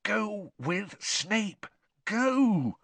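high, slow, drawn-out urging; silent plea. A narrator's reading. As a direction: plead as if willing someone away. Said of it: "Harry pleaded with him silently".

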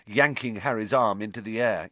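ordinary delivery, nothing marked

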